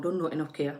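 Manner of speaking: usual speaking voice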